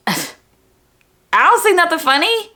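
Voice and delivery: high, drawn-out offended retort; mock indignation. Student facing laughing classmates, mock indignation.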